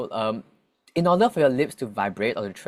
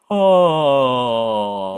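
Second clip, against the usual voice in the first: voiced yawn, loud and open; yawning sound; 11 dB louder than usual. Shown as a demonstration. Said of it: "when they're yawning like this".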